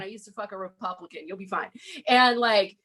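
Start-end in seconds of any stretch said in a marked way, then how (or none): 0.0-1.7 soothing aside. Retold or imitated. Calming a rowdy fan.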